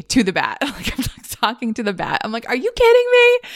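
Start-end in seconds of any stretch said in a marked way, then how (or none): 2.5-3.5 high-pitched mock outrage; exasperated outburst. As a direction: retell losing it at a bat, voice shooting up. Escalating retold outburst at the bat.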